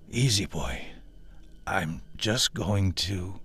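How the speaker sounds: hushed, slow, fading into a whisper; soft, trailing off. Acted. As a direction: soothe a frightened beast, then fade out. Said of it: "His voice trailed off".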